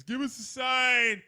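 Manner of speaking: high, loud, slow spooky plea; seance call. Retold or imitated. Mother leading a seance, slow spooky call. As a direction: call out to the spirits slowly. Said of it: "She's doing all this".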